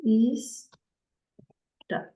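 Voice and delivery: "is da" with a hissed s closing the first syllable; "is", pause, then "da"; wrong reading. Retold or imitated. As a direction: quote the misreading "is ... da" in two separate syllables. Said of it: "yang kedua salah nih".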